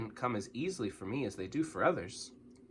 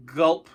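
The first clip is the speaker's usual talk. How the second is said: spoken gulp, louder, rising; nervous gulp; 4 semitones higher, 8 dB louder than usual. A narrator's reading. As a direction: gulp from nerves. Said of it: "I gulped".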